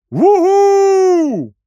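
long, very high, loud hoot; owl hoot. Acted. Owl hoots over the noisy animals.

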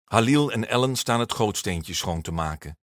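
ordinary delivery

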